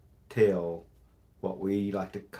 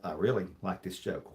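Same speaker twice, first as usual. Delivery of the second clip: normal talking voice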